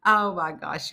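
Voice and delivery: usual speaking voice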